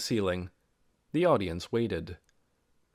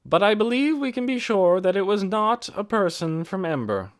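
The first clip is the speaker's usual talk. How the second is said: raised, carrying speech to a crowd; Mary's public address; 7 semitones higher, 6 dB louder than usual. A narrator's reading. Mary speaking out to the village meeting, firm and carrying. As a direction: address the whole meeting firmly.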